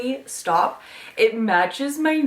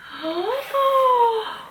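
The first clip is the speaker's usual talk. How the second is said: long, high, delighted gasp; excited gasp. Real, not acted. Showing viewers what is under the cover, excited gasp. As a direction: gasp in amazement at a reveal. Unboxing reveal delights her.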